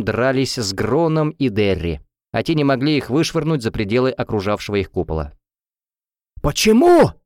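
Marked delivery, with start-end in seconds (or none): 6.4-7.1 high, loud, drawn-out cry; dismayed yelp. A narrator's reading. Students bumping an invisible wall, dismayed. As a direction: wail it in baffled dismay. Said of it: "охали воздушники".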